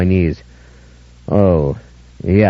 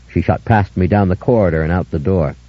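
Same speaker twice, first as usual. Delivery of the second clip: ordinary delivery, nothing marked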